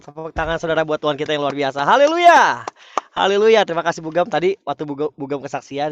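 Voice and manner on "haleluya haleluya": loud, high-pitched hallelujah; praise shout. Real, not acted. Preacher rouses congregation to applaud God, praise shout.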